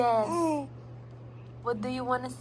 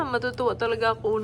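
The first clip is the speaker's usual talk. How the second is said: normal talking voice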